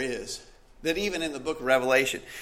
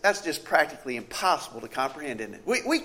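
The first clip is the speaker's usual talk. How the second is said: usual speaking voice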